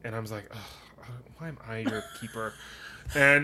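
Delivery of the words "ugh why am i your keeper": annoyed ugh. Retold or imitated. Annoyed retort to beach stranger.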